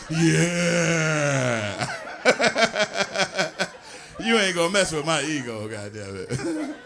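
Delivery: normal talking voice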